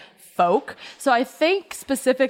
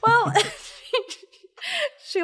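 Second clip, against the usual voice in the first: laughing; 13 semitones higher than usual. Real, not acted. Laughing as she starts.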